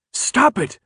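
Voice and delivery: high, loud sharp yell; alarmed shout. Acted. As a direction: yell to make him stop. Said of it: "Brenda yelled".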